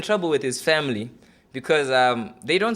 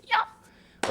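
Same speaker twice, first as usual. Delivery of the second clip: high squeal. Acted. Squeal after losing score.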